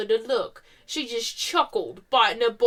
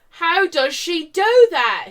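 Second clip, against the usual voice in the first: higher, louder and slow frustrated cry; frustrated scream; 7 semitones higher, 9 dB louder, slower than usual. Acted. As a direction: wail it in frustration. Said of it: "he screams in frustration".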